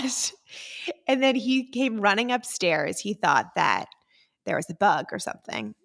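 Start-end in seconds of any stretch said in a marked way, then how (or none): 0.0-0.3 high-pitched yell for Matt; retold scream. Wife yelling downstairs for her husband after the test, re-enacted scream. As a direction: call out in a re-enacted shriek. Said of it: "I just screamed for Matt".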